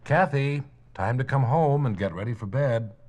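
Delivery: loud parental call from the doorway. Acted. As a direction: call her home across the yard. Parent calling child home.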